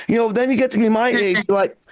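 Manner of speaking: ordinary delivery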